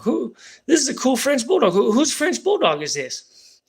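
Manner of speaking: high, eager cop asking whose dog it is; excited cop. Retold or imitated. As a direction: ask around all thrilled about the dog, slowing at the end. Cop asks about the dog.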